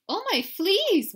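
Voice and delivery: high, loud, drawn-out wail; mother's wail. A narrator's reading. Alarmed mama sees kitty up on the wire, high wailing. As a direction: wail it out in alarm, stretching the words. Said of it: "wailed Mama Junebug Jones".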